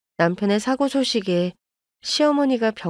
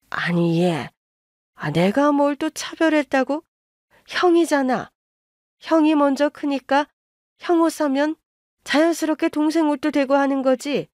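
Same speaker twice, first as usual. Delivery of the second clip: a lightly voiced indignant mother-in-law, pitched higher; defensive mother-in-law; 6 semitones higher than usual. (변명하는 시어머니 목소리, 조금 높게)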